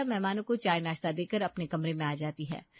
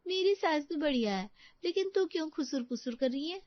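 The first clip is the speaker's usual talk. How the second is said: put-on higher-pitched young woman's voice; lightly voiced friend, puzzled by the secrecy; chatty friend; 8 semitones higher than usual. Friend answers Rita in higher voice.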